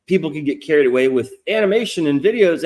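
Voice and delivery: normal talking voice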